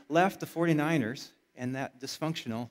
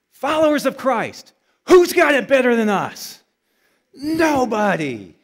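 loud, much higher call to the congregation, slow drawn-out answer; preaching rally cry; 8 semitones higher, 14 dB louder than usual. Real, not acted. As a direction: fire the church up like a coach. Preacher rallies congregation with mantra.

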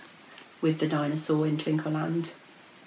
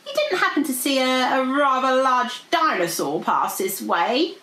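higher, lilting dragon voice; feigned casual; 6 semitones higher than usual. A narrator's reading. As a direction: ask it oh so casually. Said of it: "asked Goldie casually".

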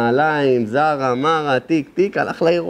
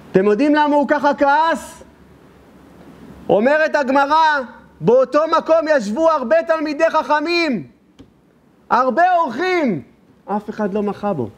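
much higher, impassioned sermon voice; fiery preaching; 11 semitones higher than usual. Acted. Preacher rebuking the silent sages to his listeners, impassioned. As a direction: preach it out with full force.

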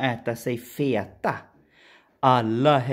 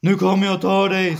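much higher, louder, fast troll threat; troll's shout; 8 semitones higher, 7 dB louder, much faster than usual. Acted. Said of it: "skrek trollet". (trollet skriker högt och snabbt)

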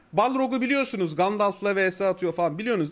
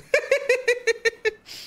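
high laugh; 14 semitones higher than usual. Real, not acted. Amused by a viewer's joke.

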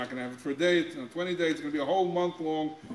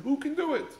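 pitched up high in a mocking whine; mock complaining cry; 10 semitones higher than usual. Rabbi mimics complaining Israelites, a high mocking whine. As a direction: mimic the grumbling people, voice jumping up. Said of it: "They said".

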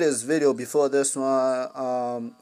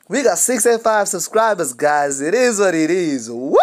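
loud, higher celebratory shout; excited celebration; 4 semitones higher, 9 dB louder than usual. Real, not acted. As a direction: whoop it up for your subscribers. Celebrating subscriber count.

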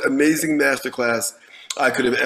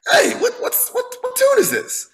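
much higher, scowling mock demand. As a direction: scowl it out, pitched way up. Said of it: "with a real scowl".

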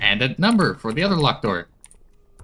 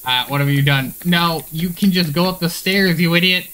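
raised-voice telling-off, climbing higher; exasperated scolding; 5 semitones higher, 4 dB louder than usual. Real, not acted. Player scolding over the stairs, exasperated. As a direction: build from dismay to a louder, higher scold. Scolds game character for blunder.

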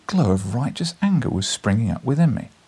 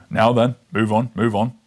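loud gruff constable; stern policeman; 5 dB louder than usual. Acted. Policeman breaking up the fight, loud and stern. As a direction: scold them like a bobby.

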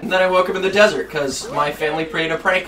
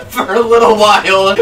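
laughing; 4 semitones higher, 3 dB louder than usual. Real, not acted. Laughing fit.

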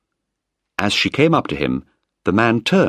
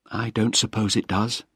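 softer, subdued reply; quietly; 4 semitones higher, 6 dB quieter than usual. A narrator's reading. Penny quietly answers Malcolm about the proposal, subdued. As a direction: let it come out quiet and thoughtful. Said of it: "she said quietly".

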